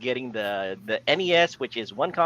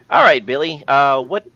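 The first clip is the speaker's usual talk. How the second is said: parent voice; 8 dB louder than usual. Retold or imitated. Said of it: "I'm imagining a parent in the U K thinking".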